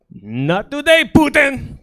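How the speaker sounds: hammed-up Russian father shouting down Putin; defiant dad. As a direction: toss the phone away with a triumphant cry. Dad rejecting unknown caller.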